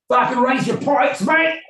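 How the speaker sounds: loud, high-pitched Cockney yell; mock Cockney shouting. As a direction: bellow at your mates like a rowdy Cockney. Acting out a rowdy Cockney telling-off.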